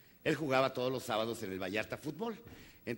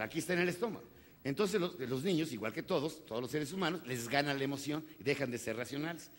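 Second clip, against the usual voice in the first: ordinary talk, nothing marked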